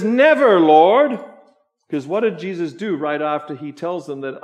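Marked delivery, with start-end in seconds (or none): 0.0-1.2 high, forceful acted outburst of protest; loud rebuke. Peter rebuking Jesus as the preacher acts it, loud rebuke. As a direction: cry out "Never" as a shocked rebuke. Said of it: "Peter rebukes Jesus and says".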